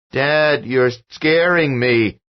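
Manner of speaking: put-on high, loud, slow scared boy; a boy terrified by his father; frightened child. A narrator's reading. Son scared of his shouting father, frightened child voice.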